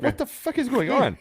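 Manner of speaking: high, incredulous outburst; baffled. Retold or imitated. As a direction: react in total disbelief. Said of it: "I'm like".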